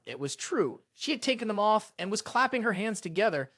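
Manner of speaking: usual speaking voice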